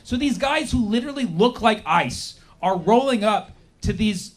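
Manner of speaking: ordinary delivery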